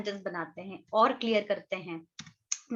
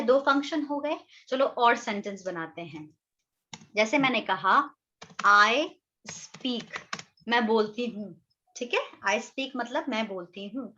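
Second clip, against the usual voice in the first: normal talking voice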